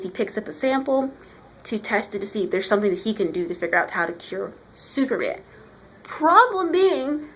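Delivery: ordinary talk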